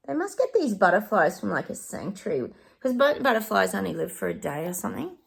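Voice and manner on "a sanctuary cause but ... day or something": ordinary delivery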